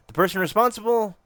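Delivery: high questioning echo; taken aback. Acted. Detective answering who is behind the murders, high questioning echo.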